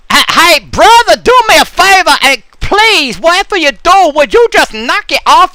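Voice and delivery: mock furious neighbor; angry neighbor character. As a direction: rant at the guy bugging your car.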